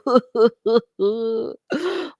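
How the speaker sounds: a real short laugh at herself; amused chuckle. Real, not acted.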